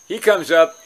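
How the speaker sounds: ordinary talk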